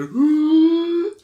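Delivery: sharp high gasp of fright; startled gasp. Retold or imitated. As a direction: gasp like someone jumped out at you. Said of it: "makes that big sound and freaks her out".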